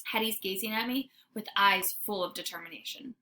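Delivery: ordinary delivery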